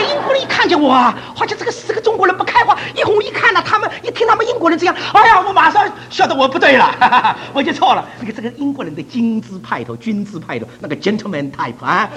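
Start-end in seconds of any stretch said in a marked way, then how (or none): none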